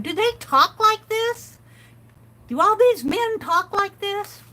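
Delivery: mock astonishment at how sportswriters talk; incredulous disbelief. Reading the sports page, she asks in amazed disbelief. 'Cause I read this whole article and I'm going.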